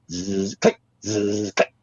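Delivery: imitated whirr and click of a film camera; camera motor drive noise. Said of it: "camera just going click".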